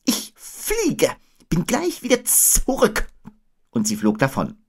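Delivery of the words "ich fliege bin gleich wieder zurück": very high owl voice; Eule. A narrator's reading. Owl announcing its flight, very high. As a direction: pitch it far up, like an owl. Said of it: "sagte Eule".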